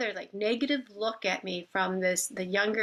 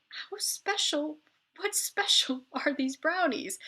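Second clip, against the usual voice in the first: incredulous inner thought; 7 semitones higher, slower than usual. Retold or imitated. Inner thought, suspecting the brownies.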